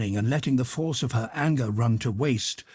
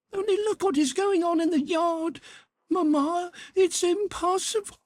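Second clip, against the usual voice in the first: put-on girl's voice pitched far higher, urgent; impassioned girl pleading. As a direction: beg her mother, near tears. Natasha pleading with her mother.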